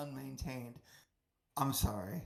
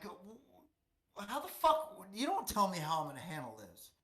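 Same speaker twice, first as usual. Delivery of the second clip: mock indignant, pitch leaping up on the curse; retold on-edge indignation; 5 semitones higher than usual. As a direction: replay an edgy inner protest, high at first. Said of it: "on edge, 'cause I'm like".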